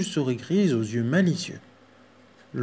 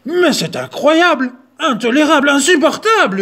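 screaming bear; 12 semitones higher, 10 dB louder than usual. Acted. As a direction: explode with outrage. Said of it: "hurla l'ours".

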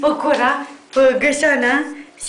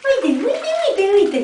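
excited pointing; 8 semitones higher, slower than usual. Real, not acted. Pointing out jumping puppy.

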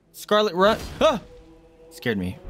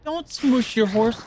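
high, slow scolding; 9 semitones higher, slower than usual. Pretend. Scolding boss on screen.

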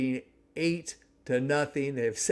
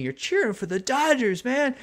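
higher, disbelieving call-out to the crowd; indignant jab at fans; 11 semitones higher than usual. Retold or imitated. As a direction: needle the people beside you for cheering the rival. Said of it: "There's people next to me".

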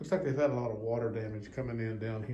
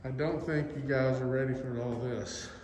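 ordinary talk, nothing marked

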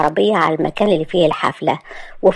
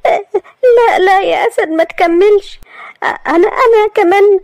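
high, louder crying plea; tearful pleading; 13 semitones higher, 4 dB louder than usual. Acted. Maria desperately interrupting Asad, crying. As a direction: beg him to stop, in tears. Maria begs him to stop.

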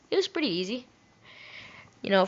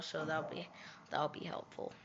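sarcastic whisper; 6 semitones lower, 17 dB quieter than usual. Real, not acted. Sarcastic aside to himself.

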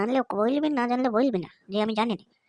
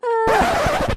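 sharp, high, loud exasperated "aah"; annoyed outburst; 10 semitones higher, 7 dB louder than usual. Acted. Boy fed up with his friend's preaching, sharp loud exasperated cry. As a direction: burst out in irritation at the lecture.

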